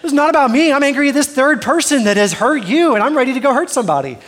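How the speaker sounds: laughing mock fury, ready to fight; hammed-up fury. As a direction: play up the protective rage for laughs. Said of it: "I'm like".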